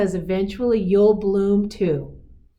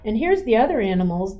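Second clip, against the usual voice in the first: ordinary delivery, nothing marked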